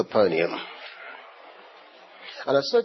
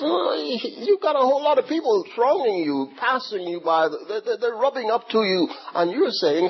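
much higher, incredulous protest; a light mock of the disciples' disbelief; disciples protesting; 9 semitones higher than usual. Preacher lightly voicing disciples protesting to Jesus, incredulous. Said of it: "Peter and the other disciples".

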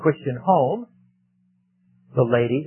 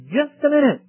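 high, louder "Just a minute"; firm call to stop; 11 semitones higher, 3 dB louder than usual. Acted. Preacher voicing a man who stops the blending, firm call. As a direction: halt them sharply. Someone drawing lines, calling out.

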